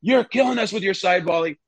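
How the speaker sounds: hammed-up halftime telling-off; mock manager rant. Manager scolding goalkeeper at halftime, mock angry rant. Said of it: "and he goes".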